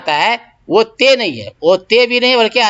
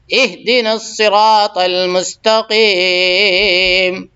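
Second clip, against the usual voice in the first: slow, drawn-out chanted recitation; Quran recitation; much slower than usual. Shown as a demonstration. Teacher reciting Fatiha verse for students, slow chant. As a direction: recite each word slowly and clearly.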